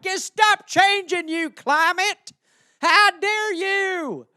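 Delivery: high, slow put-on scold; mock scolding. People yelling at the climate, slow high mock scolding. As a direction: wag a finger at the sky.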